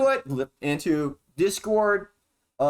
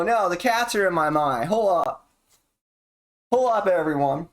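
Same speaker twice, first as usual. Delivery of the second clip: ordinary delivery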